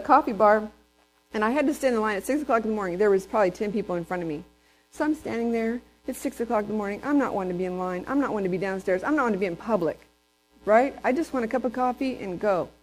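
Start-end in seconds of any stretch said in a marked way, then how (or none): none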